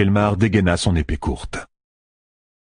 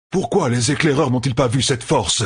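raised-pitch, incredulous demand; disbelieving exclamation; 7 semitones higher than usual. A narrator's reading. Lord questions why scouts missed the horde, exclaiming. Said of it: "s'écria le seigneur".

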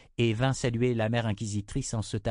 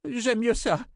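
high-pitched, haughty comeback; defiant retort; 13 semitones higher, slower than usual. A narrator's reading. (réplique hautaine, voix aiguë)

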